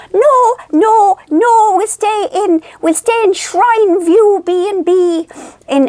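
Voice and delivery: high-pitched, drawn-out mock refusal; imagined protesting mother. Thrifty mother refusing the fancy hotel, high drawn-out protest. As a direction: play the thrifty old woman objecting, high and slow. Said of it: "so I knew she'd go".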